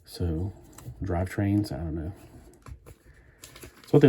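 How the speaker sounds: normal talking voice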